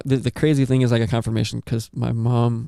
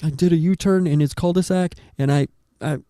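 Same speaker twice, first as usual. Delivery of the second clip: frantic retelling; 5 semitones higher than usual. Retold or imitated. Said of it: "she was like".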